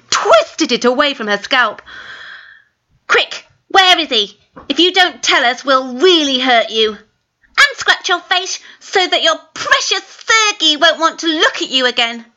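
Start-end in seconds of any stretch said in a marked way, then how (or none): 3.1-12.2 menacing. Acted. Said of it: "in an evil mood".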